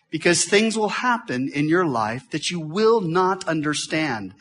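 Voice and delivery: usual speaking voice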